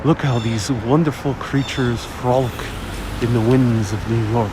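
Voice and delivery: slow, measured nature-documentary narrator; David Attenborough. Acted. Streamer showing blue jays to viewers, mock Attenborough narration. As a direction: present the birds like a wildlife film narrator, unhurried. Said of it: "That's my best David Attenborough impression".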